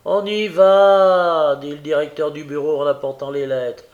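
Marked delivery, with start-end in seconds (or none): 0.1-1.6 loud, high, long-drawn answer; sing-out reply from inside an office; calling back. A narrator's reading. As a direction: stretch the last word as you call out. Director calls back to courier.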